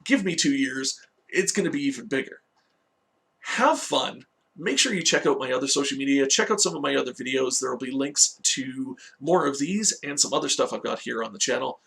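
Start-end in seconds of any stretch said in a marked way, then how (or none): none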